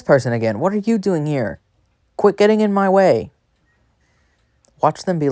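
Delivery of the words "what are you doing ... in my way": higher-pitched playful scolding; mock scolding. Real, not acted. Gamer to a player in the way, scolding. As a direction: fuss at someone in the way.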